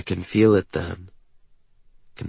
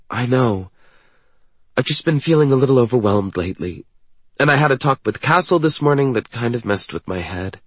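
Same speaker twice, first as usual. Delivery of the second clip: higher-pitched, quick character voice; troubled young woman, confiding; 4 semitones higher, faster than usual. Acted. Woman confiding she has felt overwhelmed, troubled. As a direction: voice her confession lightly and higher.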